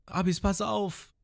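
high, slow mock warning; worried call imitated. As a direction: call out in pretend worry. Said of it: "Nami und Lysop so". (hohe, gespielte Warnung)

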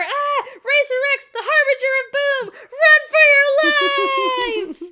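loud, high hammed-up panic, slow at the end; panicked kid shout. Spritle warning the family about Racer X, hammed-up panic. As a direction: ham up a terrified kid crying doom. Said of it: "Spritle ... screams".

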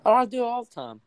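high, loud southern accent; playfully exaggerated southern accent; put-on southern accent. Shown as a demonstration. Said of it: "that's a little bit better".

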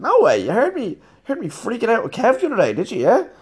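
high, loud incredulous no way; mock disbelief. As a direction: play it as shocked and flattered. His own reply, acted up.

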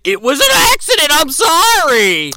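loud, high-pitched fake excuse; shrieky, insincere imitation of apologizing; mock apology. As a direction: rush the excuse, then drag out a hammed-up sorry. Acting Meg's obviously false apology.

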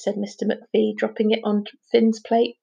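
plain reading voice

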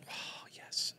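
a hushed, dreamy yes; awed whisper. Real, not acted. Dreaming aloud of joy, whispered in awe. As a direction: breathe it out in a blissful whisper.

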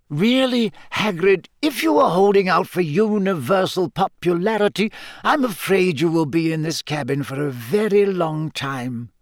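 put-on high-pitched, emphatic old headmaster; firm headmaster. Headmaster reassuring his gamekeeper, firm and insistent. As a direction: press the point as headmaster. Narrator voices Dumbledore, stern.

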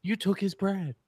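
high, teasing scold; mock scolding. Friend scolding the bread thief in a teasing high voice. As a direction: tease him for taking it. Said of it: "I'm like".

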